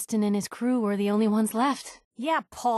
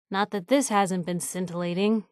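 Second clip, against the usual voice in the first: ordinary delivery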